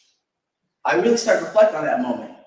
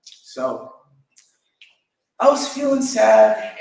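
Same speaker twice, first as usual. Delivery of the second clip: ordinary delivery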